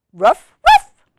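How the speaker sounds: high, loud double woof; puppy woof. Acted. Biscuit answering the reader, high loud puppy woofs. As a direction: yip like an eager little puppy. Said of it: "And Biscuit says".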